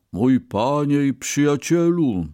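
earnest address. A narrator's reading. Ox addresses his master and friend, earnest.

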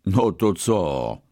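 slow, drawn-out indifference; unimpressed. A narrator's reading. Rich merchant dismisses the Brahmin's news, unimpressed. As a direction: shrug it off, stretching the words.

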